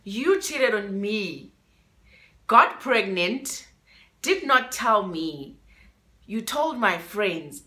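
slow, deliberate, each charge spelled out; slow accusation. Real, not acted. Telling her ex what she did, slow and deliberate. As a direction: lay out her betrayal word by word. Confronting cheating girlfriend.

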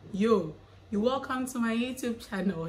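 high, sustained channel welcome; singsong welcome, held high to the end; mock YouTuber intro. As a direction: play the stock vlogger opener. Mimics stock channel intro.

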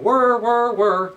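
loud high whir whir whir like a spinning wheel; whirring wheel. Acted. Storyteller to children, imitating the spinning wheel loudly. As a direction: make the wheel sing out loud.

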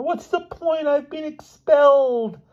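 very high squeaky wail; sobbing despair. A narrator's reading. Expelled boy telling his roommate, high wailing sob. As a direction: draw out the last wail. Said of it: "sobbed Elmer".

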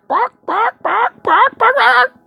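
high silly barks; mock animal noise. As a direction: yap it like a little dog. Mock chicken/dog noises.

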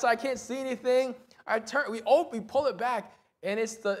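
normal talking voice

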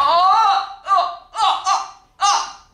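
high loud cries of annoyance; frustrated yelps. Real, not acted. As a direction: yelp at every miss.

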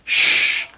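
a drawn-out unvoiced sh; just the hiss of sh; sh sound. Shown as a demonstration. Teacher isolates the sh sound. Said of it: "som de S H junto".

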